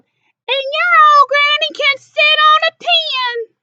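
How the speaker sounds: very high, loud squealing pig yell. A narrator's reading. Third pig yelling an insult about the wolf's granny. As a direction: shriek the insult like a rude little pig. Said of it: "the third little pig yelled".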